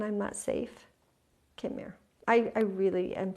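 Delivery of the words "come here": whispered come here; mother whispering to her frightened child; soft whisper. Retold or imitated. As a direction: hush it to the child. Gently calling her child close.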